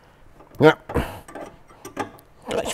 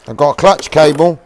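excited; 7 semitones higher, 9 dB louder than usual. Real, not acted. Excited find in spares box.

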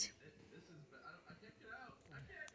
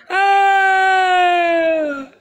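excited yell; 5 semitones higher, 6 dB louder than usual. Real, not acted. Dropping down slide.

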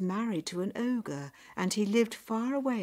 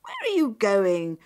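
loud, accusing shout; cross mother's raised voice; 2 semitones higher, 11 dB louder than usual. A narrator's reading. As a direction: yell it at a daughter sneaking off. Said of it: "her mother shouted".

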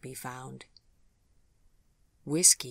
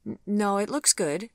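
high, hurried, a bit louder; flustered quick denial; 7 semitones higher, 4 dB louder than usual. A narrator's reading. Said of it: "she said quickly".

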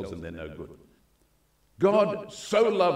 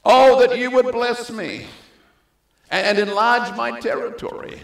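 much higher and louder pleading cry; 12 semitones higher, 9 dB louder than usual. Acted. Jabez crying out to God, high and loud. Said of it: "Jabez cried out to the God".

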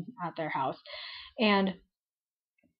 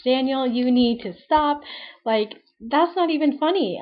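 a raised voice telling Daniel to stop; retold scolding; 5 semitones higher, 7 dB louder than usual. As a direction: tell him off, half laughing. Scolding Daniel over tarantula gift.

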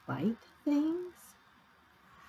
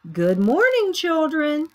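high, loud classroom singsong; teacher's greeting; 8 semitones higher, 7 dB louder than usual. Acted. Teacher welcomes children to class, singsong greeting. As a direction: greet a whole class of little ones.

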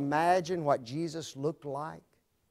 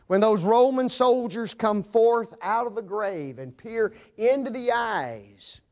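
ordinary talk, nothing marked

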